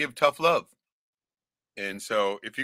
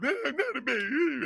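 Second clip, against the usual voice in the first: put-on high, whining little-kid voice, much higher; whiny toddler protest; 13 semitones higher, 5 dB quieter than usual. Teased youngest sibling whines back at his siblings, high and whiny. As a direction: play the year-old kid insisting he's not a baby.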